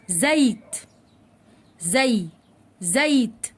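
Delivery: "زيت" with the y glide fully audible after z; very clear ya. Shown as a demonstration. Teacher showing children ya with sukun, carefully enunciated. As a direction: break off "زي", then complete "زيت". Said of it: "ونطقت الياء بشكل واضح جدا".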